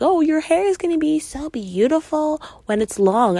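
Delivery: high, loud sugary aunt voice; mock sweet auntie praise; mimicking aunt. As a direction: gush like a doting aunt. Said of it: "my aunt was like".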